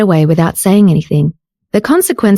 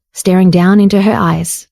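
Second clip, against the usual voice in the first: ordinary delivery, nothing marked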